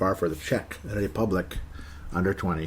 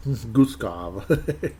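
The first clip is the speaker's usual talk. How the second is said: laughing. Real, not acted. Laughing at the name.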